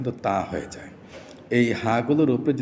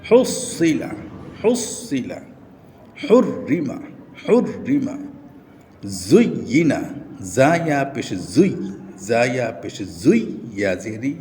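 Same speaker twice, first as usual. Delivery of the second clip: recitation drill; 3 semitones higher, slower than usual. Shown as a demonstration. Recites Quran reading drill words.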